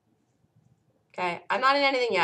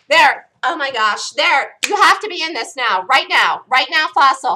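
loud, eager calls of right now; excited urging; 3 semitones higher, 10 dB louder than usual. Real, not acted. Trader urging chat into the trade, loud and eager. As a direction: push the chat to get in now.

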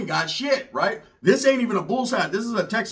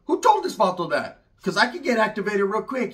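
ordinary delivery

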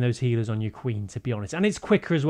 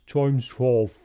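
slow motion voice; much slower than usual. Pretend. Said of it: "that's me doing a s-, slow motion voice".

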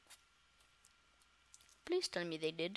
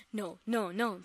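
ordinary delivery

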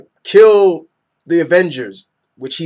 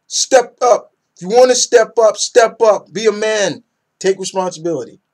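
raised, loud exhortation; forceful scolding; 6 semitones higher, 4 dB louder than usual. Acted. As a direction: tell him off, high and loud. Acted scolding of Cyclops.

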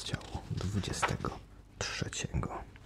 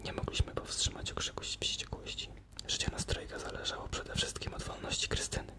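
hushed storytelling whisper; whispered narration; 9 dB quieter than usual. A narrator's reading. Narrator telling the captives' plight in a whisper. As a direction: breathe the tale in a tense hush.

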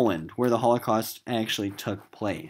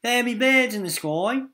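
excited; 14 semitones higher, 6 dB louder than usual. Acted. Sailor excitedly reporting birds.

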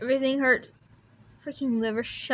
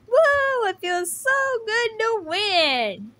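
high whoop then slow gloating; triumphant cheer; victory whoop; 10 semitones higher, 5 dB louder, slower than usual. Acted. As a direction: celebrate the win. Celebrating a victory.

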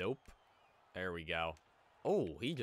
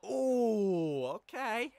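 high, loud "ooh" of surprise; excited whoop; 8 dB louder, much slower than usual. Real, not acted. Player reacting to a play in his hockey game, excited. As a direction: let out a high-pitched impressed reaction.

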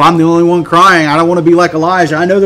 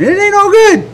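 'ain't all good' swooping very high; knowing contradiction; 14 semitones higher than usual. Acted. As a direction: contradict it in a high, incredulous pitch. Contradicting the fake all good.

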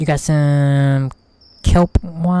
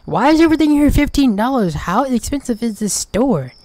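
high loud outraged question; much higher disbelieving complaint; indignant surprise; 8 semitones higher, 4 dB louder than usual. Real, not acted. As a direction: protest the prices. Complaining about prices.

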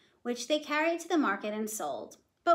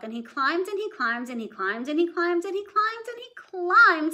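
each climb higher and louder; storyteller building the repeats; 7 semitones higher, 4 dB louder than usual. A narrator's reading. Repetition builds.

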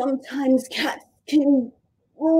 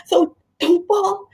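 higher, urgent pretend warning; mock tightrope alarm; 8 semitones higher than usual. Teacher urging kids across pretend tightrope, high and urgent. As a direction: warn them of the alligator below, playfully. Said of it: "very hungry alligator down there".

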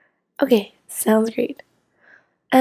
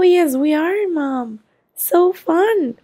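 usual speaking voice

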